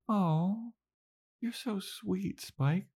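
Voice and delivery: soft, very high cooing; smiley Buffy Bot. Retold or imitated. Buffy Bot gushes to Spike, high, soft and smiley. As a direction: gush sweetly in a high, chipper robot-girl voice. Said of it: "with the smiley chipperness".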